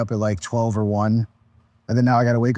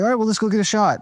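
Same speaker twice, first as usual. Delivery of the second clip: quoting the producer, pitched much higher; eager, high-pitched producer pitch; Jimmy's voice; 10 semitones higher, faster than usual. Retold or imitated. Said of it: "And then he's like".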